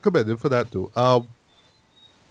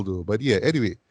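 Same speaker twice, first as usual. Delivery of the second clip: ordinary talk, nothing marked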